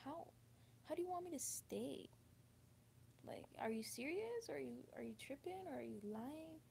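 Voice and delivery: a hint of incredulous inner voice; surprised disbelief. Recalling her reaction when asked to stay, mild disbelief. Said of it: "I'm just surprised, thinking".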